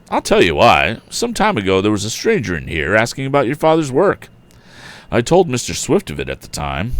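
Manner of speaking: steady, even read-aloud pace; reading a chapter book to listeners; story narration. A narrator's reading. Reads chapter of novel aloud.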